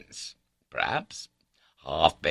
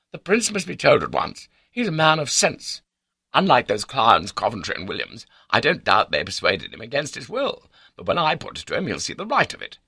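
high, emphatic self-assurance; exultant confidence; 9 semitones higher, 5 dB louder than usual. A narrator's reading. Baronet planning to tell the prince, confident. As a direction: declare it with lofty certainty. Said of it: "He was full of exultant confidence".

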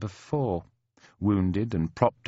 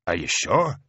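understated, wary Irish question; suspicious; 2 semitones lower than usual. A narrator's reading. Mick doubting the stranger is the colonel, suspicious. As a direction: ask it with a touch of doubt. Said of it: "says Mick, all suspicion".

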